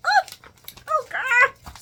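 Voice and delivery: put-on high, loud, wowed "oh, oh, girl"; stunned admiration; amazed gasp. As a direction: squeal at her entrance. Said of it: "I was like".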